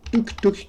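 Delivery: very high, light "touc" clicks; high mouth clicks; playful tick sounds. Real, not acted. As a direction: click the pieces in with a squeaky sound. Sound effects placing puzzle pieces.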